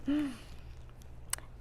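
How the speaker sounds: soft startled gasp; sharp gasp. Acted. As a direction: catch your breath in shock. Rigo shocked by the demand.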